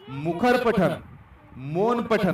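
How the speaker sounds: मुखर and मौन each hit with force, then falling; stress on both terms. Shown as a demonstration. Teacher demonstrates stress to students, leaning hard on मुखर and मौन. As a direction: punch मुखर पठन, pause, then punch मौन पठन. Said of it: "इन दो शब्दों के ऊपर मैंने बलाघात दे दिया".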